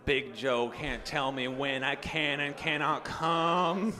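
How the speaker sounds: slow Texan drawl; King of the Hill character. Acted. Comic does every King of the Hill character, slow drawl. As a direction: stretch it out in a slow cartoon Texan voice. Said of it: "Are you doing every character?".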